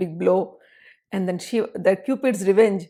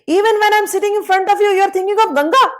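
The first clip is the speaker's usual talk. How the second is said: high, louder jealous reproach; jealous accusation; 11 semitones higher, 5 dB louder than usual. Acted. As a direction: accuse him, stung and jealous. Jealous Lalita accusing Shiva.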